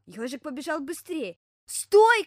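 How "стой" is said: high, very loud shout; bear cub's shout. Acted. Bear cub stopping the running hedgehog, loud high shout. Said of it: "крикнул медвежонок".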